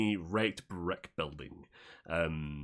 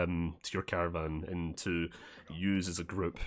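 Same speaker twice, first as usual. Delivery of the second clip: usual speaking voice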